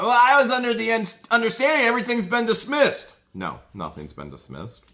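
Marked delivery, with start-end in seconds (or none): 0.0-3.1 high, loud mocking imitation of her; squeaky put-on voice mocking the witness. Mocking her court answer.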